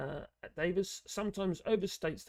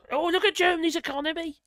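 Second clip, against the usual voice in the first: high, loud, fast mocking quote; exaggerated excitable jeer, rushed and loud; mock Brexiter; 12 semitones higher, 9 dB louder, faster than usual. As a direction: parody a Brexiter crowing, pitched way up. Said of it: "Although the Brexiters do try to".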